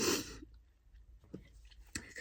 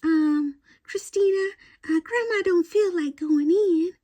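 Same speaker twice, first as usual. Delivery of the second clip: put-on high, part-whispered old lady asking a favor; grandma voice; 12 semitones higher, slower than usual. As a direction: imitate grandma coaxing her granddaughter. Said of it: "And she'd say".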